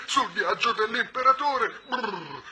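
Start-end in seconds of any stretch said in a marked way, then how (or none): 1.9-2.4 shivering brrr. Acted. Cold creature shivering.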